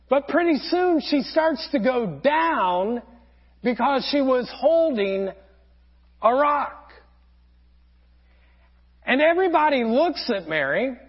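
ordinary talk, nothing marked

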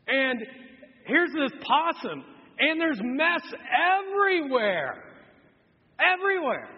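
exasperated exclamation. Retold or imitated. Retelling the disgusting mess.

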